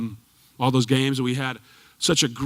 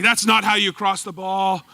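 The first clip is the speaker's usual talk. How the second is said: louder, higher exasperated scolding; mock coach telling off; 5 semitones higher, 4 dB louder than usual. Retold coach scolding his players in a raised voice. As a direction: playfully ham up the old coach's outrage. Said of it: "And he's like".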